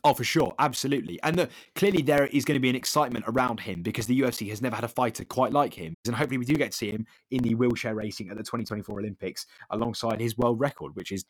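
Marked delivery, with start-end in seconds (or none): none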